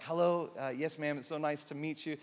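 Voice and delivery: soft, polite yes ma'am; meek polite greeting. Retold or imitated. Pastor retelling meeting the prophet, meek and soft. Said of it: "I'm like".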